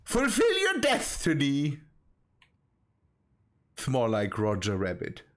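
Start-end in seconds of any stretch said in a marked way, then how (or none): 0.0-1.8 high, lisping cartoon voice; heavy lisp. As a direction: lisp it like a silly cartoon duck. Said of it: "you have to speak that with a heavy lisp".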